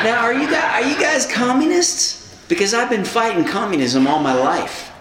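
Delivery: a half-hearted rural American drawl through a laugh. Retold or imitated. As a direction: retell the suspicious Illinois worker in a lightly put-on rural American drawl. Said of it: "a drawl".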